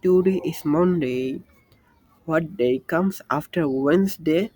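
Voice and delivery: slow, careful classroom English; slow drill. Shown as a demonstration. Teacher models a question for students, slowly. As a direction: spell it out slowly for beginners. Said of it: "slow drill for learners".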